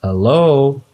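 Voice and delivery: loud, high call into the dark. Retold or imitated. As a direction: call out nervously into the hangar. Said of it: "Call out".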